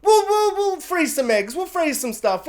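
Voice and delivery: high, airy mock voice; high, mocking parody of a flippant wife; mocking Siobhan. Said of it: "when she's like".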